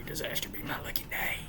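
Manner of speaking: hushed whisper punchline. Acted. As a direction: breathe the line out secretly.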